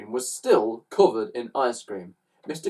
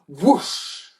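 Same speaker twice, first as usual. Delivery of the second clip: breath-filled, rushing whoosh; whoosh effect; 6 semitones higher, 4 dB louder than usual. A narrator's reading. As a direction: whoosh like something flying through air. Narrator imitates van flying up.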